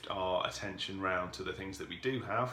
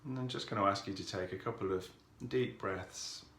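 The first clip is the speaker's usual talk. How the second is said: calm, softened voice with long pauses; guided meditation; 4 dB quieter than usual. Real, not acted. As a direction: ease listeners into stillness, unhurried and even.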